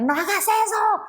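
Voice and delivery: high-pitched mock parental yell; grito de regaño. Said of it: "uno lo primero que hace es gritar". (grito agudo imitando a un padre que regaña)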